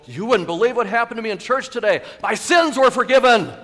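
loud, high, elated shout; thrilled outcry, building; bursting with excitement. Acted. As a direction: blurt amazing news to a friend. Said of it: "so excited that you can't wait".

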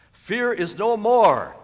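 loud, high, drawn-out cry; exultant proclamation. Acted. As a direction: proclaim victory, stretching every word. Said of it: "he says happily".

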